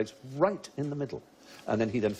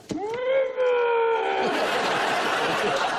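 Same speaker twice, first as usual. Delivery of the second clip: high, loud strained puffing squeal; puffed-up pufferfish; 3 dB louder than usual. Pretend. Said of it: "give me your impression of a pufferfish on the pull".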